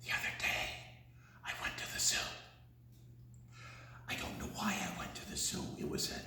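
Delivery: whispered, fast and hushed; secretive storyteller whisper. Shown as a demonstration. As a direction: breathe the words out in a whisper. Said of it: "whispered verse".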